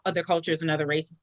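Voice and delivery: usual speaking voice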